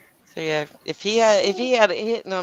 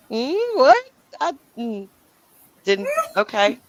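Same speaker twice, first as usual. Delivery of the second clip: baffled; 9 semitones higher than usual. Retold or imitated. Stunned by the wife's accusation.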